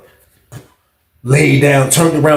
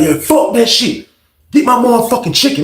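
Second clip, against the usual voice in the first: higher, stretched-out defiance; acted anger; 9 semitones higher than usual. Inmate demanding his chicken, acted anger. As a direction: lightly imitate an inmate blowing up. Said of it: "Aaron is blacking out like this".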